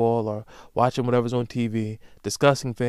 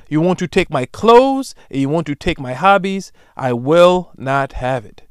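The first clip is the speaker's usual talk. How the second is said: indignant; 2 semitones higher, 7 dB louder than usual. Real, not acted. Indignant ending of rant.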